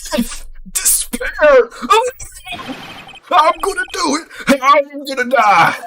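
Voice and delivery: high, wailing melodrama; dramatic despair. Acted. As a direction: wail as if all is lost. Voicing Larry in despair.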